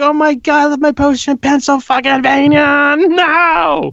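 high, anguished cry of loss, acted out; distraught wail. Dreamer panicking over his poster left in Pennsylvania, high anguished wail. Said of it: "I was so upset".

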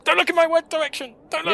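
high, loud, rapid put-on command; mock bossy order. Little girl ordering Ratcliffe around, high-pitched hammed-up shout. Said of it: "ordering him around".

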